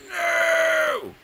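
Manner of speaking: high, loud drawn-out cry of dismay; anguished no. Real, not acted. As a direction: wail it as the round slips away. Losing at the game.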